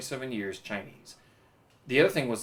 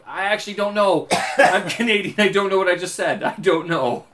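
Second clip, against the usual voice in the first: louder, higher, laughing it off in a panic; flustered protest; 5 semitones higher, 8 dB louder than usual. Retold or imitated. To an angry Man U fan, a flustered loud backpedal. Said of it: "I'm like".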